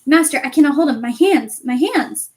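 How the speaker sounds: loud pained cry of hands; anguished cry. Acted. Quirrell crying to his master in pain, loud. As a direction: howl in agony.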